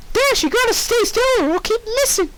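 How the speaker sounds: high, bubbly cartoon pony voice; squeaky pony girl; Pinkie Pie voice. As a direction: chirp it like a cheerful pony.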